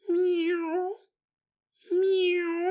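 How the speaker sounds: high mock meow; playful high kitty meow; kitten meows. Imitating the kitten.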